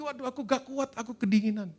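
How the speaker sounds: high, whiny shiver of complaint; cup crying out. Acted. Clay cup cooling down, high whiny complaint. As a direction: whine like a cold little cup. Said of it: "dia teriak lagi".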